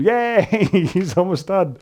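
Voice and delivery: high, slow cheer through a laugh; mock cheer. Rower at the machine cheering that the chat is almost over. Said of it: "you're in the rowing machine or whatever going".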